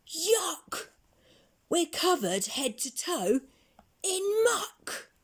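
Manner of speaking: high, loud disgusted squeal; disgusted yuck. Acted. Covered in muck, disgusted.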